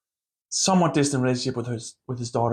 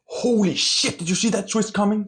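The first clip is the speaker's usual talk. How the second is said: pitched-up, mock-astonished exclamation; mock shocked reader; 8 semitones higher than usual. Vlogger mimics a stunned thriller reader, mock excited. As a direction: act out a reader gasping at a twist.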